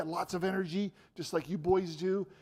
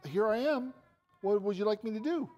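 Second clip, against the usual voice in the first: put-on eager young Samuel; 6 semitones higher, 5 dB louder than usual. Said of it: "and he said".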